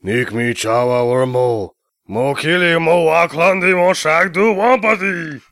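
gibberish alien speech; Jabba the Hutt. Acted. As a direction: mumble like Jabba. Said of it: "The mighty Jabba".

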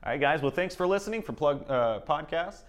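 high, loud show-closing announcer. Acted. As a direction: sign off like a radio host. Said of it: "maybe close us out".

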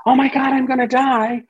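high, loud panicked cry; panicked part. Retold or imitated. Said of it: "if a part's".

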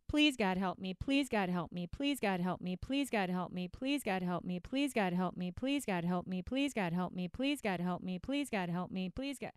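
rote, repetitive mock prayer; praying dieter. Dieter praying instead of acting, mock rote prayer. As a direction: chant the plea in an identical falling tune. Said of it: "after I've eaten my cupcakes".

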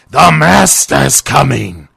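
loud, high, drawn-out crazed cry; Renfield. Acted. Crazed asylum patient announcing his master out the window, loud high cry. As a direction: shout it slowly out of a window like a madman. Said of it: "just leaning out the window like".

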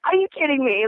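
shocked disbelief. Retold or imitated. Contestant reliving her shock for the host, high and stunned. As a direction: let the disbelief burst out of you.

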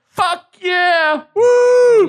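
high, loud cheering yell; retelling his own victory cheer; excited whoop. Retold or imitated. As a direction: whoop it up in triumph. Celebrating shorter drive time.

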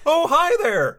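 high, hearty put-on hello; character greeting. Retrying the character's greeting, high put-on voice.

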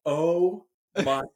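mortified dad. Retold or imitated. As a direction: retell a parent's dismay. Dad mortified by son's yelling.

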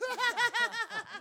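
high cackle. Pretend. Ranting host ends in laugh.

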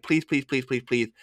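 soft, rapid imitated begging; hushed mock plea; pleading. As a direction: beg under your breath, word after word.

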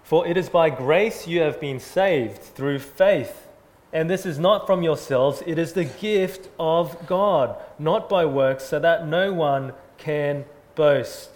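slow, loud, deliberate reading of the verse; scripture reading. Acted. Pastor reading the Bible verse to the church, slow and loud. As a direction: proclaim the verse slowly to the congregation.

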